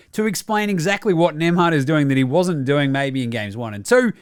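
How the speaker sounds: ordinary delivery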